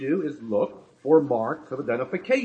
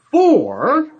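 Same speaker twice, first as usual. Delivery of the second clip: preaching burst; 7 semitones higher, 10 dB louder than usual. Real, not acted. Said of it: "emphatically stating".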